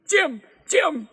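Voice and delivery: high, loud repeated screams; screamed name. A narrator's reading. Panicking woman screaming for Jim, high and loud. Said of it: "the woman screamed".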